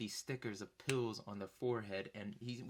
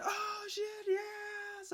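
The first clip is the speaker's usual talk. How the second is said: high re-enacted fan scream; elated yell; much slower than usual. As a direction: yell it high, overjoyed at winning the encore. Retold joy at getting encore.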